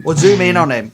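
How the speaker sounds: loud urging; insistent, loud. Real, not acted. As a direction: push them to zoom in. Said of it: "urging the player".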